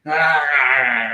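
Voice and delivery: short battle cry; shout. Acted. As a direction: yell it out.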